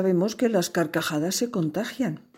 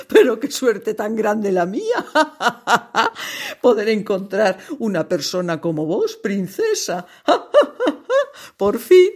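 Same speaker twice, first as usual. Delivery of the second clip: speaking through big bursts of laughter; can't stop cackling between words; laughing; 4 semitones higher, 6 dB louder than usual. Acted. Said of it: "soltó una sonora carcajada".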